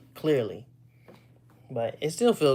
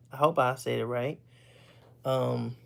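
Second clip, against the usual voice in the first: ordinary talk, nothing marked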